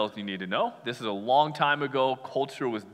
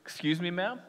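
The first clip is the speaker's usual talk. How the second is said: cool formality; 5 semitones higher, 5 dB quieter than usual. Retold or imitated. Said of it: "Jesus goes".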